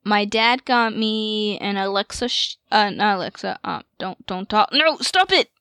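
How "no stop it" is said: sharp scolding. Real, not acted. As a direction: snap it at the device. Scolding the Alexa nearby.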